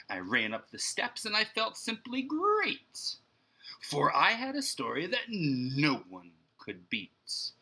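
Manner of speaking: put-on lively, rhythmic reading to children; storybook read-aloud. A narrator's reading. Teacher reading Mulberry Street to her class, lively and rhythmic. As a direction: bounce the rhymes like a picture book.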